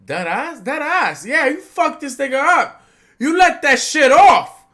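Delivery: builds from loud to very loud and high; hyped yelling. Retold or imitated. Fan recalls hyping up Momonosuke while reading, building hype yell. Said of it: "I'm like".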